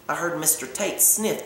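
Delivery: usual speaking voice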